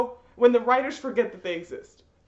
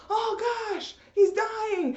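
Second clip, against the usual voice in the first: mock panicked friends; 10 semitones higher, much slower than usual. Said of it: "his friends are like".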